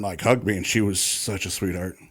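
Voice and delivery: usual speaking voice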